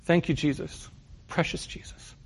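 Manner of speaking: a hint of a Norwegian accent, fading to a whisper; lightly imitating a Norwegian grandmother's accent. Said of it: "In her thick Norwegian accent".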